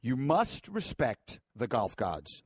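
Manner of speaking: slow, weighty words, leaning on must; solemn decree. Acted. Host lecturing his co-host on golf-god rules, slow and weighty. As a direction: lay down the sacred law slowly.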